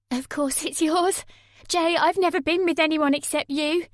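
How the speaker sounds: desperate insistence. Acted. Sammy swearing to Jay the baby is his, pleading. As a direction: insist with everything you have.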